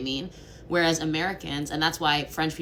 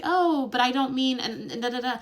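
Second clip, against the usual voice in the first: higher, louder anxious backpedalling; nervous hedging; 9 semitones higher, 4 dB louder than usual. Retold or imitated. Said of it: "they'll be like".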